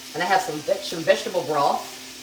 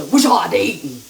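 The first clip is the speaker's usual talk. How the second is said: louder, half-joking tough talk; mock rough voice; 7 dB louder than usual. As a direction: play it rough and loud, only pretending. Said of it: "want me to be rough".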